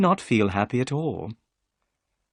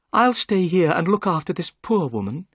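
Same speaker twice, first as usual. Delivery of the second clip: put-on higher, firm young lady; lightly voiced Miss Morstan; 8 semitones higher, 4 dB louder than usual. A narrator's reading. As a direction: take charge calmly.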